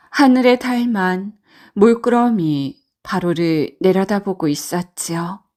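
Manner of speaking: usual speaking voice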